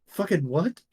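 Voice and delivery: startled, loud disbelief; incredulous. Acted. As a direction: blurt it out in shock. NPC reacting in disbelief.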